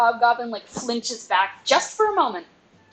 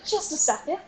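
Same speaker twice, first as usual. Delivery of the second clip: usual speaking voice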